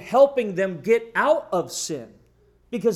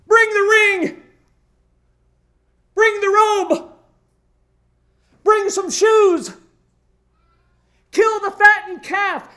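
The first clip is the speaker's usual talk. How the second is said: high, loud, ringing orders to the servants; the overjoyed father shouting for the robe and ring; joyful father's commands; 11 semitones higher, 7 dB louder, slower than usual. Acted. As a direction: call out for the feast in delight.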